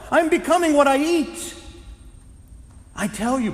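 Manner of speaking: impassioned preacher building to a peak; fervent preaching. Acted. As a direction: testify with full fervour. Preaching testimony at full force.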